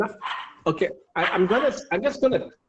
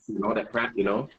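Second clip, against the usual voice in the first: put-on funny voice; 9 semitones lower, 5 dB quieter than usual. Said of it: "Keep the voice".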